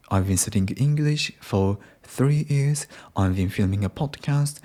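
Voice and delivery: uptalk, lifting "English", "years" and "podcast"; rising intonation. Shown as a demonstration. Demonstrating unconfident Japanese learners' uptalk, rising intonation. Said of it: "goes up".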